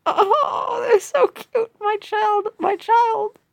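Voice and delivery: high-pitched adoring squeal; gushing over cuteness. Acted. Smitten by the little quadruplets, high gushing squeal. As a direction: coo and wail over something unbearably cute.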